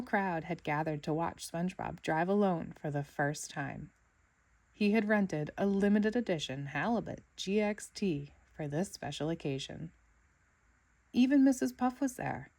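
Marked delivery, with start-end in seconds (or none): none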